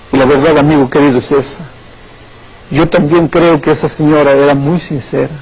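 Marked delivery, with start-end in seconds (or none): none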